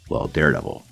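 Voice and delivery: normal talking voice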